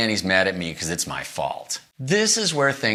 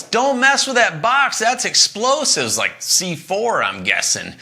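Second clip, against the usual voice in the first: yelling warning; 11 semitones higher, 4 dB louder, slower than usual. Retold or imitated. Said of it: "Guy yells".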